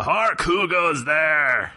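theatrical, drawn-out elder's challenge; hammy stage actor. Acted. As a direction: call out like an old stage herald. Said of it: "and he goes".

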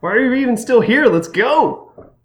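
put-on high-pitched, impatient teen excitement; eager urging. Schoolgirl rushing friends out of school, excited. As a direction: hurry everyone along in a high voice.

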